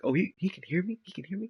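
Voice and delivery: high, rising surprised question; mock surprise. Rock learning the ape hears him, high mock surprise. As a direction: feign being caught out. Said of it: "He's like".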